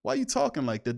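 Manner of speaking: high-pitched what's wrong with you; incredulous. Real, not acted. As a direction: ask it in total disbelief. Incredulous reaction to coach.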